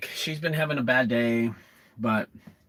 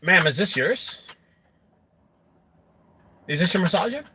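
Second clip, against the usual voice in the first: sly mock-innocent question rising higher at the end; acted question; 5 semitones higher than usual. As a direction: play it innocent, lifting the second question higher. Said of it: "I pull it out and I go".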